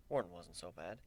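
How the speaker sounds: usual speaking voice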